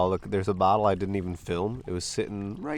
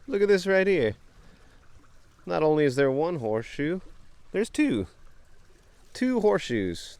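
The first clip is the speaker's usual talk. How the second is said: excited; 8 semitones higher than usual. Real, not acted. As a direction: gasp at the find of the day. Finds two horseshoes.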